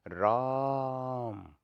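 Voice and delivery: "राम" with the "आ" sustained very long; extra-long vowel. Shown as a demonstration. Teacher mimicking a call to Ram, extra-long vowel. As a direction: call "राम", stretching the vowel to three beats. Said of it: "राम को कुछ ज़्यादा ही देर तक बोला जा रहा है".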